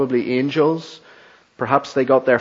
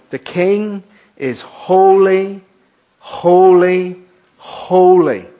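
holy, holy, holy each pushed higher and louder, slow; emphatic repetition; 4 semitones higher, 6 dB louder, slower than usual. Shown as a demonstration. Preacher showing Hebrew emphasis, each holy louder. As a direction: lean hard on every holy. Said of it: "here's a super superlative".

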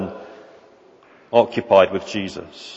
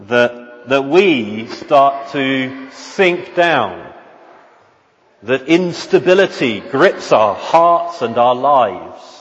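higher, louder, rising preaching; impassioned preaching; 4 semitones higher, 4 dB louder than usual. Acted. Preacher urging the congregation to keep their eyes on Jesus: impassioned. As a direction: build the fervour of the sermon.

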